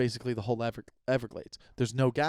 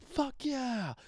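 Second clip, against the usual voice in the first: much higher, cheering like a champion; excited cheer; 12 semitones higher, 4 dB quieter than usual. Retold or imitated. Telling his mom about excitement, high mock cheer. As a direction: celebrate a Super Bowl win. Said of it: "I was excited".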